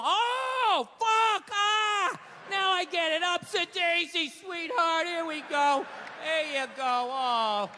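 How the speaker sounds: high mock-tender helper voice; getting up from chair. Wife beater helping wife up.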